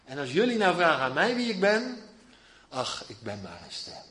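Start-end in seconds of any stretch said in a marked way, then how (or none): 2.7-4.0 zacht en laag, bescheiden; nederig zacht. Acted. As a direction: laat het wegvallen, nederig. Johannes antwoordt nederig. (zachtjes en nederig als Johannes)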